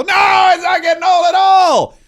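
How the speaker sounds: high, loud, slow hammed-up yell; mock crowd shout. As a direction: bellow it like an adoring crowd. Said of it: "They go".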